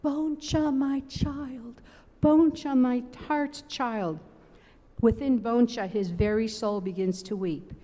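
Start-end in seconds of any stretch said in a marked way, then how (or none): 0.0-4.2 slow, higher, tender call; gently voiced loving judge. A narrator's reading. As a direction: cradle each word like a parent. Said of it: "loving and tender".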